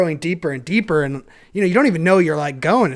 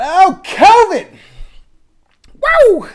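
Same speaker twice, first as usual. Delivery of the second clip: much higher, louder excited cheer; excited whoop; 14 semitones higher, 10 dB louder, much slower than usual. Real, not acted. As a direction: whoop it like a party. Excitedly naming the cabin.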